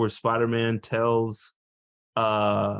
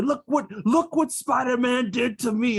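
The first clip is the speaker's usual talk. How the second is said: high-pitched mock grievance; put-on aggrieved villain, pitched up; villain's accusation; 9 semitones higher than usual. Podcaster imitating Electro blaming Spider-Man, mock-aggrieved.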